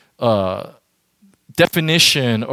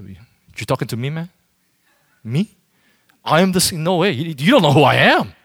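indignant, louder boast building up; arrogant person; 6 dB louder than usual. Acted. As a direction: puff yourself up, offended. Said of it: "you know what an arrogant person would say".

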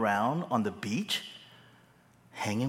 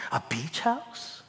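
ordinary talk, nothing marked

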